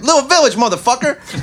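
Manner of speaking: exasperated yell. Retold or imitated. Sonny Boy yelling the song title at the producer, exasperated. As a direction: bark it out as a fed-up bluesman. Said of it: "And he's like".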